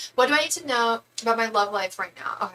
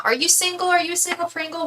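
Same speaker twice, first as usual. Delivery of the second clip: playful teasing; 10 semitones higher than usual. Acted.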